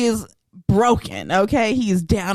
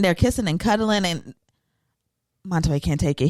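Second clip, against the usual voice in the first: ordinary delivery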